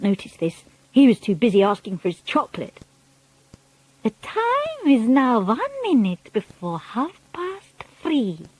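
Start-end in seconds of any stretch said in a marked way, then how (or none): 4.0-8.5 slow, high witch voice announcing; Grand High Witch. A narrator's reading. Grand High Witch announcing the time to her witches, slow.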